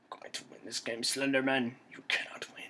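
soft, secretive determination; muttered vow. Real, not acted. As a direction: mutter it under your breath at the enemy. Repeats the vow low at monster.

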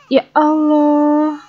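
drawn-out sigh. Real, not acted. Overwhelmed by crying babies.

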